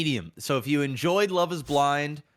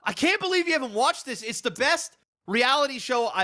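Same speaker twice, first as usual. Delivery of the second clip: high-pitched enthusiastic rave; excited hype; 9 semitones higher than usual. Real, not acted. Fan selling co-host on a favorite show, excited hype. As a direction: gush about it in a raised pitch.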